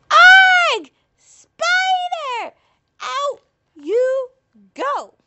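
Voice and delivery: high furious shriek; berserk rage. Acted. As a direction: yell it out of the house. Family shouts spider out third time.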